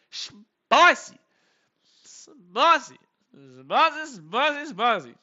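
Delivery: drawn-out high relish of the word; excited spicy. Real, not acted. Streamer relishing a hot fastball, high and loud. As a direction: savour the pitch out loud.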